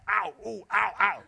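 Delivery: high, loud, hammed-up ows of sore feet; a big guy's mock cries of pain, high and loud; mock pained yelps. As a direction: yelp like a big man hobbling in pumps. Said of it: "he was screaming 'cause his shoes hurt".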